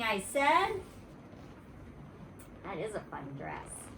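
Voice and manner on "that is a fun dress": quiet aside. Real, not acted.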